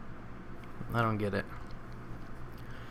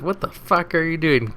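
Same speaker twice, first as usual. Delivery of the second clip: higher, angry demand with "fuck" stressed; livid outburst; 6 semitones higher than usual. Retold or imitated. Livid husband screaming at the doctor. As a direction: blow up at him in disbelief. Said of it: "the husband is livid".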